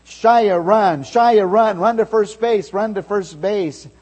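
loud high cheering calls; crowd yelling. Retold or imitated. Teammates yelling Shia on toward first, loud and high. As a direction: yell him on to first base. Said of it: "everyone started yelling".

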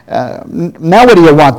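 angrily. Acted. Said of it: "the woman asked angrily".

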